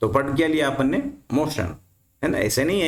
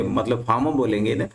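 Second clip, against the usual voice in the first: normal talking voice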